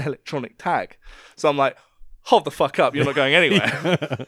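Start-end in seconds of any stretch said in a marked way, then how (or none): 2.2-4.2 louder, firm MC halt; a mock-stern host stopping her leaving; stern stop. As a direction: hold her there with a loud command. MC stops tagged girl leaving.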